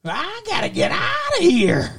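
high, twangy cartoon prospector; Yosemite Sam. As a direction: squeal it like a cartoon gunslinger. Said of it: "Is that Yosemite Sam or?".

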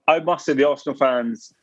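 ordinary talk

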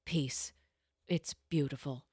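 ordinary talk, nothing marked

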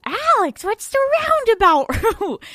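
high, loud, squealed mock question; mock listener. Playing a listener asking Alex, mock squeal. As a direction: squeal it like an over-eager listener, half laughing.

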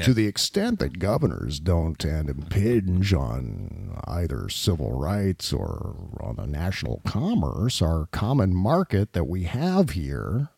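low, slow, soft and reasonable; Bill Barr voice. Retold or imitated. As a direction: drone it out calmly like an unflappable attorney general. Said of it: "the always reasonable Bill Barr voice".

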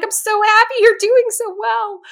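high, loud gushing cheer; gushing joy. Retold or imitated. Fan cheering on runners she doesn't know, high loud gush. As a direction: squeal with delight for them.

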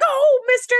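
high, bright mock Guinan voice; high sing-song greeting voice; Guinan's greeting. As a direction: pitch way up as a delighted Guinan. Guinan quote, high far voice.

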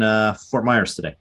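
usual speaking voice

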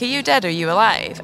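just say it straight out, bluntly. Retold or imitated. To dead grandparents, blurting the question bluntly. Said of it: "Like, really bait like that".

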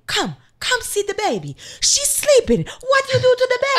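hammed-up Italian nonna, proud and scolding; Italian accent. Neighbor showing the sleeping baby, in high Italian accent. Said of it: "I like when [name] does accents".

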